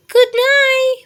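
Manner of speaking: high, loud mouse call. A narrator's reading. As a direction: call it out across the room. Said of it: "Mouse called a little louder".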